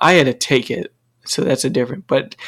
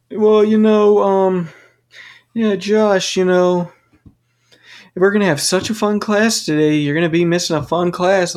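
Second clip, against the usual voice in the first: higher, sing-song fake-cheerful teacher; mocking the teacher; 5 semitones higher than usual. Instructor telling sick Josh he'll miss a fun class, mocking sing-song. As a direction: mimic him sweetly rubbing it in. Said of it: "he's like".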